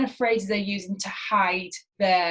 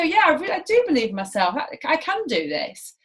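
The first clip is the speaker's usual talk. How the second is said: confident; 6 semitones higher than usual. Shown as a demonstration. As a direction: sound like you truly believe it. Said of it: "That's different".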